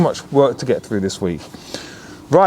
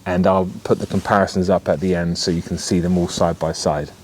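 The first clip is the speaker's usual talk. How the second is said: normal talking voice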